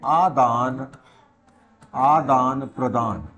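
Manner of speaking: slow, stretched-out key term; slow dictation. Real, not acted. Teacher naming electron give-and-take for students, slow dictation. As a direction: draw each word out for note-takers.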